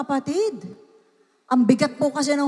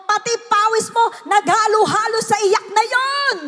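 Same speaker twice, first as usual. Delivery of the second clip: raised, high-pitched preacher's voice; full-force preaching; 6 semitones higher, 3 dB louder than usual. Real, not acted. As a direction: press it home with rising urgency. (malakas at madamdaming pangangaral)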